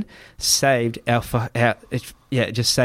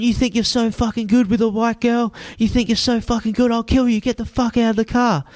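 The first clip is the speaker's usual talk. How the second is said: high, raging hammed-up threat; mock enraged threat; 10 semitones higher than usual. Taxi driver threatening the boyfriend, mock enraged. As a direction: lose it on them. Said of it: "he's just lost and going".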